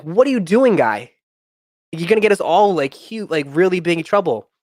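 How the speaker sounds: half-shouting at a friend who just made things worse; alarmed outburst. Retold or imitated. Yelling at kid with pills.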